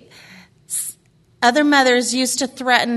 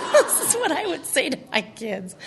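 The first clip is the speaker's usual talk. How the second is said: talking through giggles, pitched up; laughing; 5 semitones higher than usual. Real, not acted. Speaker amused by her own parenting quote, laughing. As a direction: squeeze the words out through laughter.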